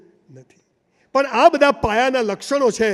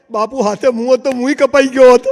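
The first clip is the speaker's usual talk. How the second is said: louder, triumphant retort; punchline; 3 semitones higher, 9 dB louder than usual. Acted. Old minister answers the king, loud triumphant retort. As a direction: land the punchline loud and proud.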